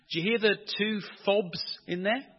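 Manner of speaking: ordinary talk, nothing marked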